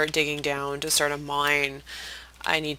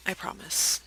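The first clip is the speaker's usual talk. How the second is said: whispered promise; 2 semitones higher, 7 dB quieter than usual. Acted. Mock-sad promise to viewers.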